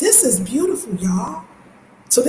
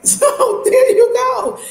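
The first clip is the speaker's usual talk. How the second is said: laughing; 5 dB louder, much slower than usual. Real, not acted. Laughing wrap-up.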